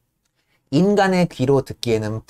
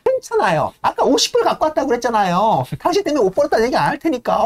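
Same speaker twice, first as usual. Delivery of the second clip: much higher, quick and dismissive; breezy heiress; 11 semitones higher than usual. Retold or imitated. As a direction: wave his worry away lightly. Lady brushes him off again.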